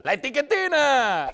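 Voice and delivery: hammed-up full-volume shout; comic yell. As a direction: belt it out with a long held vowel. Shouting like an excited cheer.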